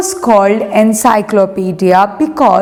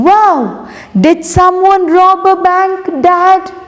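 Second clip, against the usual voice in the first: higher, lightly voiced young son, excited; eager boy's voice; 6 semitones higher than usual. Teacher reading to class voices the excited son. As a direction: play the curious kid asking Dad. Son Encyclopedia speaks in story.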